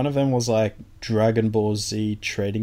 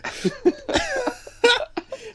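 high squealing laugh. Real, not acted. Laughing at mums joke.

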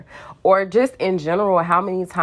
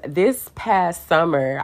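ordinary talk, nothing marked

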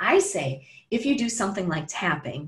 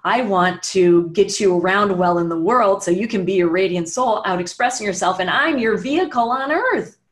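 the knee speaking; 7 dB louder than usual. Pretend. The knee answering its owner, rising earnest plea. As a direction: plead like a loyal body part wanting to help. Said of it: "It would be like".

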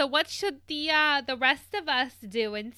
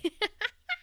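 high laugh; 10 semitones higher than usual. Real, not acted. Laughs at own joke.

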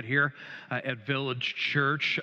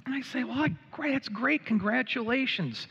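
flustered congratulations; 10 semitones higher than usual. Retold or imitated. Pastor retells his flustered reply.

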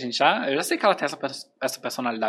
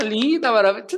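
gushing praise; 7 semitones higher than usual. Retold or imitated.

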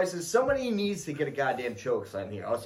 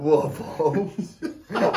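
very slow laughing "What about?"; mock catchphrase, stretched; imitated catchphrase; much slower than usual. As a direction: draw out "What about?" slowly and silly. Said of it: "I heard somebody say it before".

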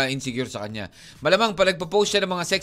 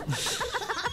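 high squeaky laugh; 8 dB quieter than usual. Real, not acted. Laughs at teasing question.

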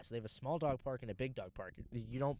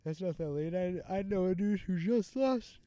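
yawning; 6 semitones higher, 5 dB louder than usual. Real, not acted. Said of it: "sorry for yawning".

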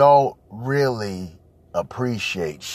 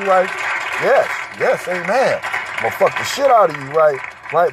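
usual speaking voice

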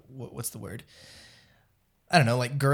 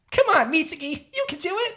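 put-on much higher, eager anime boy cheer; shonen boy voice; 14 semitones higher than usual. Cohost urging her friend on in a high shonen boy voice. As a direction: cheer him on like a young anime hero. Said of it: "Let me hear your shonen voice".

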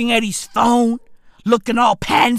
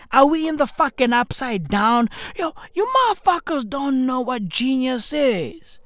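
ordinary talk

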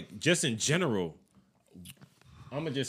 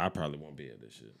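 ordinary delivery

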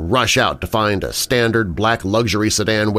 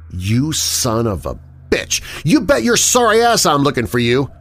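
screaming rage; 6 semitones higher than usual. Acted. James screaming at the killer through the receiver. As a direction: explode at him down the phone. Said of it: "I scream it into the receiver".

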